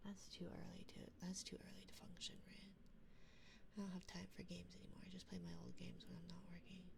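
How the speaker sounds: quiet whisper for a sleeping partner; hushed late-night whisper. Real, not acted.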